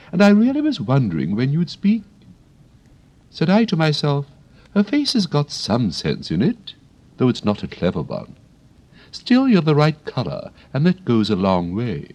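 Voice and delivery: high, prim flower voice; a proper lady of the garden; prim Rose. Acted. The Rose chats to Alice, high and prim.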